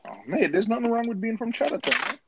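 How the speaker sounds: very high mock Chatterton voice; mock Chatterton accent. Defending Chatterton in jest, high mock local accent. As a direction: plead the case in a squeaky put-on Chatterton accent.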